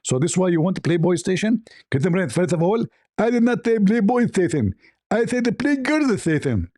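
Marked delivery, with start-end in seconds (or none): 1.9-6.7 high, indignant caricature voice; hammed-up high-pitched denial; Zakir Naik. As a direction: protest squeakily in mock outrage. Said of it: "Zakir Naik, it's recorded".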